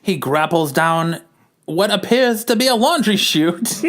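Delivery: put-on high-pitched old-time narrator reading the scene; old-timey narration. Narrates the comic in a voice.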